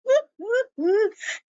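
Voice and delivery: squealing high laugh; real high-pitched giggle. Real, not acted. Laughing at the scene.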